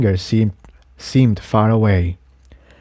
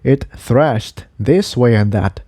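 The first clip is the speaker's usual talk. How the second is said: plain reading voice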